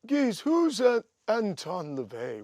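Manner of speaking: lightly hammed-up puzzled [name]; imitating Oz. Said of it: "he goes".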